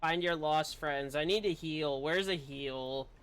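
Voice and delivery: ordinary delivery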